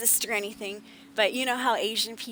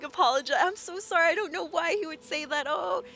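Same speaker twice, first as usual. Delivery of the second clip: higher, apologetic and sweet; imitating Katsumi; 7 semitones higher than usual. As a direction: lightly play a kind, sorry viewer. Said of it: "She was like".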